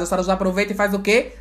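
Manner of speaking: ordinary talk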